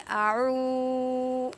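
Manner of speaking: اعو with the و held long; drawn-out vowel. Shown as a demonstration. Teacher showing the correct long vowel, drawn out. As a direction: stretch اعو slowly, one alif length. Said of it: "ایسے پڑھیں گے".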